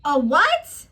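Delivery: loud, stunned "a what"; sudden outraged surprise; shocked disbelief. Real, not acted. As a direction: blurt it out in disbelief. Disbelief at the rub-down remark.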